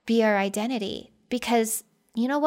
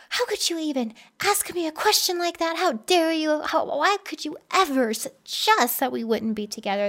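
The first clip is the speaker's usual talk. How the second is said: raised, indignant retelling, pitch higher; mock outrage; 6 semitones higher than usual. Retelling her outburst at husband Jeff, indignant. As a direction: replay the hurt indignation. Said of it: "I was so mad. And I said".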